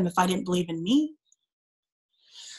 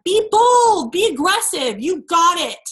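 high, loud rallying shout; encouraging cheer; 7 semitones higher, 4 dB louder than usual. Retold or imitated. Mom cheering her son on at soccer, loud and bright. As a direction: pump him up from the sidelines. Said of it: "we say things like".

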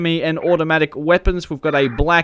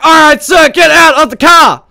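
high, loud shout at the driver; police command shout; 13 semitones higher, 13 dB louder than usual. Pretend. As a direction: bark the order to get out. Officer ordering suspect from car.

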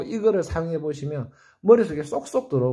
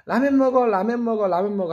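repeated nagging, fading off; 6 semitones higher than usual. Shown as a demonstration. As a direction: nag them to eat ramen, over and over. Voicing someone nagging three times.

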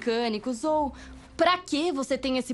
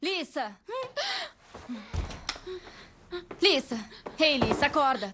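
calling out; 5 semitones higher than usual. Real, not acted. Waking friend from nightmare.

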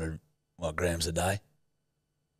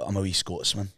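low, soft Scottish accent; 5 semitones lower, 6 dB quieter than usual. Shown as a demonstration. Host teaches co-host the line, Scottish accent. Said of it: "They do Scot-, Scottish accent".

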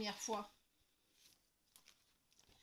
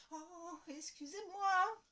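embarrassed apology; 6 semitones higher, 6 dB louder, slower than usual. Acted. Embarrassed again.

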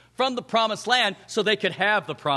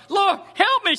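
high, louder begging cry; raised, pleading cry for help; desperate plea; 10 semitones higher, 4 dB louder than usual. Acted. Woman begging Jesus.

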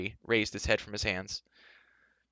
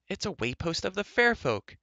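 high-pitched character voice; high, marvelling announcement; Elenwy, amazed; 9 semitones higher than usual. A narrator's reading. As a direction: say it in a higher voice, as a discovery.

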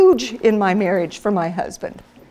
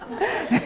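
high laugh; 11 semitones higher, 6 dB quieter than usual. Real, not acted. Laughing at the story.

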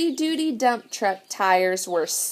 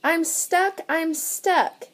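high-pitched toy truck voice; pitched-up, acted distress; little truck crying out; 7 semitones higher than usual. A narrator's reading. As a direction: beep and plead like a small stuck truck. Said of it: "cried the little blue truck".

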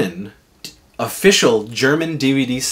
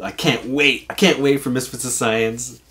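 usual speaking voice

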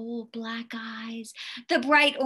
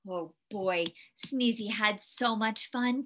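ordinary delivery, nothing marked

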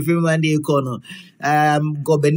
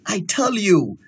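usual speaking voice

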